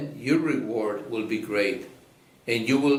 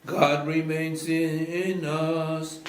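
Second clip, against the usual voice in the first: slow chant in a cathedral echo; 5 semitones higher, slower than usual. Acted. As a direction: sing it out into a big echoing church. Cantor chants verse in reverberant church.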